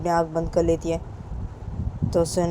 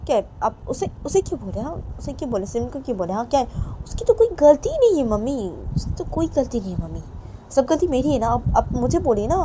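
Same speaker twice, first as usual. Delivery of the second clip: higher, louder insistent pleading; pleading daughter; 6 semitones higher, 5 dB louder than usual. Acted. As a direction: beg her mother to blame her instead. Daughter takes blame, pleads loudly.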